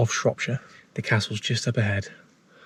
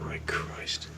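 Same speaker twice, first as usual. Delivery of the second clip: scared whisper in the dark; jumpy whispering ghost hunt; 10 dB quieter, slower than usual. Real, not acted. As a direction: breathe the words out, spooked.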